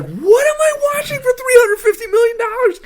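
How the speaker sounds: high, loud, fast cry of disbelief; incredulous shout. Retold or imitated. Ranting to a friend about a movie budget, shouted disbelief. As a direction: yell it like you can't believe the price tag. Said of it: "And I was like".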